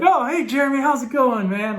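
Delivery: imitating the coach's put-on excitement on the phone; mock excited coach. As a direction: play the coach's fake cheery hello. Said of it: "acting all excited".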